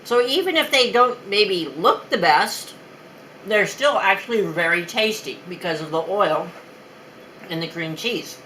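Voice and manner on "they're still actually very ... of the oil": ordinary talk, nothing marked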